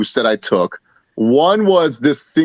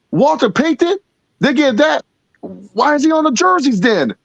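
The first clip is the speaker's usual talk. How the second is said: high-pitched disbelieving questions; retold puzzlement; 10 semitones higher than usual. Friend told it's Walter Payton, baffled. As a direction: imitate a friend who cannot make sense of it.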